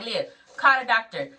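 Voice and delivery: loud, high retold command; urgent order. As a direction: call it out like a supervisor. Retold urgent order in trauma bay.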